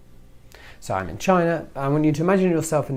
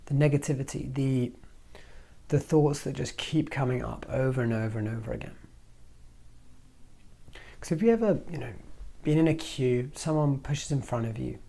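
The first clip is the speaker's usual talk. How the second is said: ordinary talk, nothing marked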